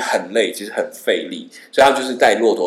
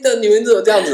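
higher imitated girl, half-teasing; girl's complaint. Girl complains to the traveller, lightly voiced high. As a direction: whine like the girl.

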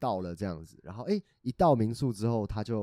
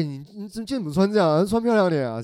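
imagined parent; 9 semitones higher, 8 dB louder than usual. Retold or imitated. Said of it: "我真的很怕说".

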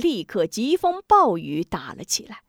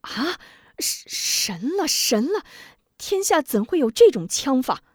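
stunned amazement; 5 semitones higher than usual. Acted. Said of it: "大惊失色".

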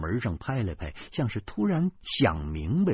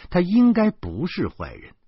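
ordinary delivery

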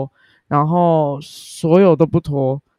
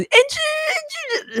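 fans shouting a name; 4 dB quieter, much slower than usual. Retold or imitated. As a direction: call her name like a fan in the stands. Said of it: "乱叫这样".

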